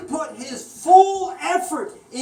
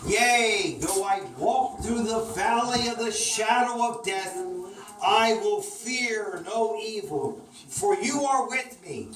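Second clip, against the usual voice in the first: scripture reading; 5 semitones lower than usual. Acted. Reads Psalm to children.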